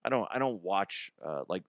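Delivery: usual speaking voice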